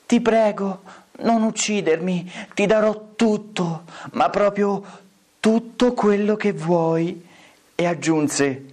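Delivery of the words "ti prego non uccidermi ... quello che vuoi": small, high mock-innocent plea; feeble little voice. A narrator's reading. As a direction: beg in a tiny raised voice. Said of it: "con una vocina flebile, flebile".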